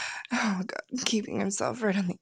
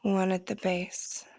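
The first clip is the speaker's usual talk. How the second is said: intimate whisper. Acted. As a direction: whisper it close into the ear. Whispered instructions resume.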